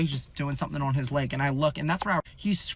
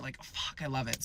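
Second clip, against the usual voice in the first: muttered aside; 4 semitones lower, 9 dB quieter than usual. Real, not acted. Aside about loving love.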